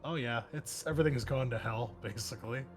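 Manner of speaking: ordinary talk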